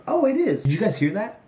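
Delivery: high, hammed-up gasp of discovery; mock surprise. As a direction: act like you just heard him crash in. Pretends Samoa Joe arrives.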